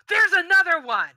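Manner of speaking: guards' alarm. Acted. Guards calling out on spotting another target, loud alarm. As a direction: call out loud and high to fellow guards.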